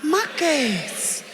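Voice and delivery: slow, deliberate, unbothered; slow firm retort. Pretend. Telling the crowd how to answer hinderers, slow and deliberate. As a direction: say it slowly and calmly back at them. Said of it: "Just look at them and say".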